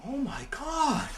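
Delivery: high, soft, drawn-out gasp of dismay; slow, hushed shock up high; stunned horror. Acted. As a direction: realize your awful mistake. Father realizes his mistake.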